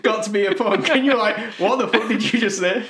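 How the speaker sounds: high, laughing mock disbelief; laughing retelling. Retelling a friend's reaction to misheard lyrics, laughing. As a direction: laugh through an imitated shocked reaction.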